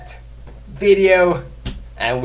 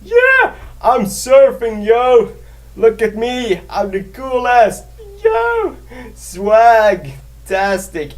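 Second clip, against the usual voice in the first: high, whooping show-off surfer dude; mock-cool surfer bragging; 6 semitones higher, slower than usual. Imagining surfing on a unicorn's back, whooping show-off. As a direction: crow it out high, stretching the words. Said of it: "and be like".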